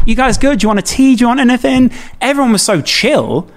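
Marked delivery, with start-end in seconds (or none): 0.1-1.9 high, bright mock-cheerful offer, Scouse-ish midwives; light imitation of cheery nurses offering tea. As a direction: chirp it like a busy friendly midwife.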